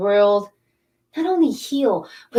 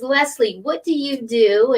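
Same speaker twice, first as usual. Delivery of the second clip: normal talking voice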